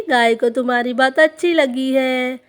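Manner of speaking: usual speaking voice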